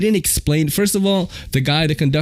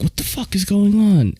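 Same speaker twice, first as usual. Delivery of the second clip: higher-pitched retold outburst; agitated demand; 10 semitones higher than usual. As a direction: play an agitated man demanding answers. Rushing guard bursts into cabin.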